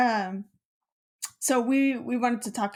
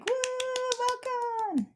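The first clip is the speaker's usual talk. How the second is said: high, breathy crowd-style woo; crowd cheer; slower than usual. Shown as a demonstration. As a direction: sound like a cheering audience. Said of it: "We need, like, a clapping thing".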